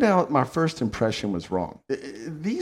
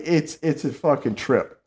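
ordinary talk, nothing marked

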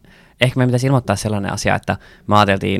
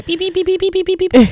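very high, short beeping "pip" sounds; imitated drive-through beep; slower than usual. Imitating the McDonald's filter's beeps for a friend, high beeping. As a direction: chirp like a fast-food ordering machine. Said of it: "sit kuuluu se mäkkäri".

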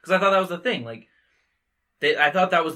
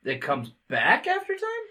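surprised; 12 semitones higher than usual. Real, not acted. Doubting the other's explanation.

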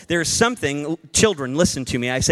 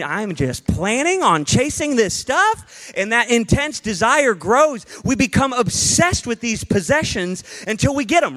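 normal talking voice

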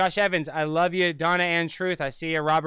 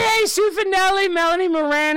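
much higher, louder, bubbly greeting; excited shout-out; 13 semitones higher, 4 dB louder than usual. Real, not acted. Host greeting viewers in chat, high and excited. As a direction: sing out the names like a thrilled host. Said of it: "Well, I'll just say".

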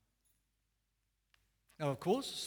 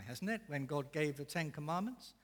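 ordinary talk, nothing marked

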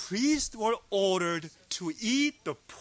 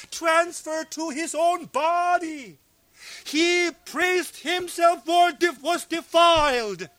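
loud, raised preacher's voice; impassioned preaching; 5 semitones higher, 5 dB louder than usual. Real, not acted. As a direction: preach it out with full force. Preaches at full force.

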